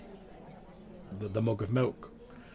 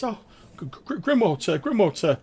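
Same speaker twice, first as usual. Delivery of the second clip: high-pitched, fast, nervous squeak; a tiny sprite squeaking out frantic apologies; squeaky high voice; 12 semitones higher, 8 dB louder than usual. Acted. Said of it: "squeaky, high-pitched voice".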